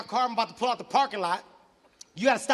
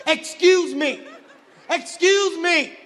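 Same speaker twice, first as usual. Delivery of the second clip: loud, higher-pitched demand for attention; insistent call; 8 semitones higher, 7 dB louder, much slower than usual. Acted. Man snubbed in lunch line, loud insistent call. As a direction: call out until they turn around. Calling the woman to turn round.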